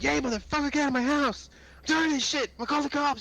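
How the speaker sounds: high-pitched hammed-up yell at an intruder; mock furious yelling. Bursting through his door to yell at his brother, mock angry shout. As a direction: pretend to storm in and scream at the "neighbor". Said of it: "I'd bust up my door".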